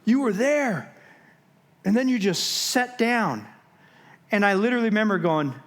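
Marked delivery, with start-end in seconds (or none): 0.0-3.5 amazed coworker. Retold or imitated. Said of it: "And he goes".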